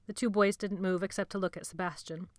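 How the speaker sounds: usual speaking voice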